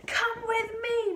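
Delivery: high, loud, slow command; yelled. A narrator's reading. Mister Cranky yelling at George, grabbing his arm. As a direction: yell it and grab him. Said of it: "Mister Cranky yelled".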